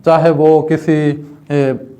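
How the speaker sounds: normal talking voice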